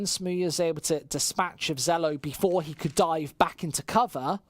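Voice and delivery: normal talking voice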